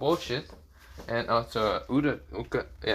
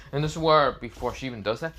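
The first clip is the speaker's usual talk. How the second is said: plain reading voice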